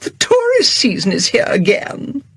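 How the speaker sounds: a hint of strangled fake upper-class sneer; strangled would-be posh voice. Diner behind Agatha sneering at her, mock posh. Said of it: "strangled would-be upper-class voice".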